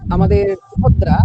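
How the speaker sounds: usual speaking voice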